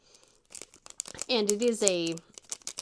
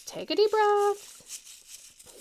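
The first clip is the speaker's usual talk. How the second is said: very slow, high soothing coax; reassuring the nervous driver, slowly; calming advice; 10 semitones higher, much slower than usual. Retold or imitated. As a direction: stretch it out to calm him down.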